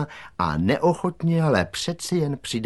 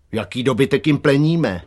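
mildly baffled question, higher and louder; puzzled; 5 semitones higher, 6 dB louder than usual. A narrator's reading. Gang member questions the wording of the challenge, puzzled. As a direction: ask loudly, not quite following. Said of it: "nerozuměl Velká noha".

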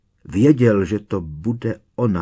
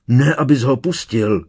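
raised, higher, louder cry; 4 semitones higher, 6 dB louder than usual. A narrator's reading. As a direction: call out an urgent warning. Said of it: "vykřikla Berta". (naléhavý výkřik)

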